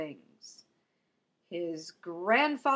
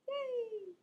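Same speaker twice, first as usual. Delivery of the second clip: high mock cheer, quietly; a deadpan fake celebration; mock joy at the lost luggage; sarcastic yay; 7 dB quieter than usual. Real, not acted.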